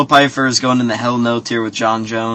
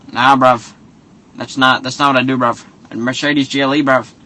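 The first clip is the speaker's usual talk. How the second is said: a hint of London accent, dismissive "bruv"; mock London accent. Son-in-law refusing to cook, lightly voiced London accent. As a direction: shrug it off in a light put-on London voice. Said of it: "he's just saying bruv too much".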